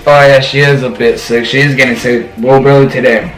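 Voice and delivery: ordinary delivery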